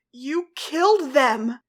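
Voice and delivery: slow, loud accusing shout; loud retort. A narrator's reading. Ladybug shouting back at Cat Blanc, loud and slow. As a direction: hurl it back at him. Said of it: "Ladybug retorted louder".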